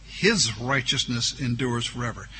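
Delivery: His hit firmly at the start; His pressed, the rest falling away; stress on His. Shown as a demonstration. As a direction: put the weight on His, not on ours. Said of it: "He doesn't say our righteousness".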